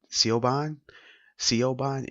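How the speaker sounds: Siobhan read as "see-oh-BAHN", questioning; spelling-based mispronunciation. Acted. Reader guessing at the spelled name, puzzled mispronunciation. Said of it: "it's spelled S I O B H A N".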